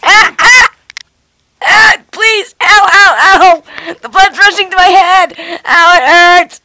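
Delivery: loud cries of pain; pained yelling. Acted. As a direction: yell in pain. Being dangled upside down.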